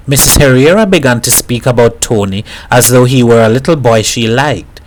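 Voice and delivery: ordinary delivery, nothing marked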